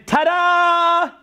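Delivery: sing-song high ta-da; mock fanfare. Speaker mocking the arrival of the internet, sing-song reveal. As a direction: announce it like a magic trick.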